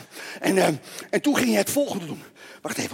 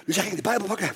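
ordinary talk

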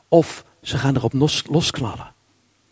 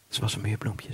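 softer echo of the line just said; quiet; 6 semitones lower, 12 dB quieter than usual. Shown as a demonstration. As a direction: drop to a soft, hushed repeat.

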